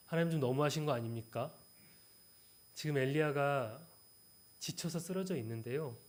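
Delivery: ordinary delivery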